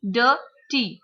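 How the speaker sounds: DIRTY split as DIR, pause, TY; broken into syllables. Shown as a demonstration. Teacher showing students the two syllables, split apart. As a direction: say "dirty" in two separate beats. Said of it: "break the word into".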